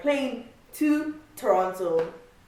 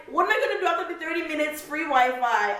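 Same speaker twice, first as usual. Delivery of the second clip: exasperated; 7 semitones higher than usual. Retold or imitated. Frustrated in airport.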